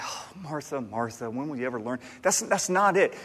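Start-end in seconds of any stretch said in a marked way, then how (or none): 0.0-2.0 much softer, sighing out both names; weary sigh. Retold or imitated. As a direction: sigh the names like an exasperated husband. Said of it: "like, with a sigh".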